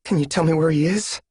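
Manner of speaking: a choked-back daughter asking; swallowed thickly. Acted. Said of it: "she swallowed thickly and said".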